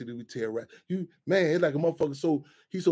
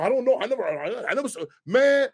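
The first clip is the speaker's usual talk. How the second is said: ordinary talk, nothing marked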